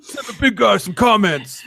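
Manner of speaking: playful attempt at a wrestler's demand; Ryback wrestling voice. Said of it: "like your wrestling voice".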